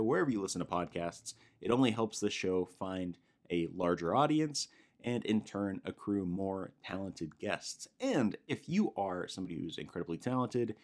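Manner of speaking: soft, steady sign-off read; podcast outro read. Acted. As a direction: wrap up the show like a host.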